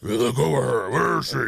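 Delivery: loud, high gravelly superhero growl. Retold or imitated. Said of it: "the damn voice".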